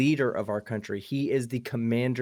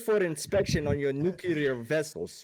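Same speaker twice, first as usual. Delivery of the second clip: mock Soviet officer, put-on Russian accent; hammed-up Russian accent announcing an inspection; 5 semitones higher than usual. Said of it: "can you say in your best Russian accent".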